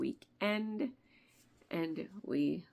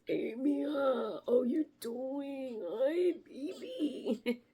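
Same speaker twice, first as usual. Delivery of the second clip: raspy, higher imitation of an uncle; smoker's voice; 7 semitones higher, much slower than usual. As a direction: rasp it out like a smoker. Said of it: "My Uncle David had this really cool smoker's voice".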